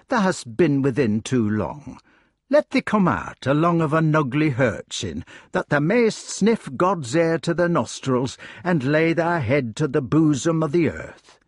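broad dialect, gently coaxing; kindly rustic hedgehog. Acted. Hedgehog coaxing the broken-hearted king outdoors, kindly. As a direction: invite him out with homely dignity.

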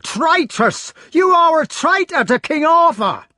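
high, loud, furious accusation; shouted rage at the Queen; raging shout. A narrator's reading. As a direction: hurl it at her in jealous rage. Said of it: "beside himself with rage".

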